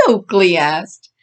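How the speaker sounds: ordinary delivery, nothing marked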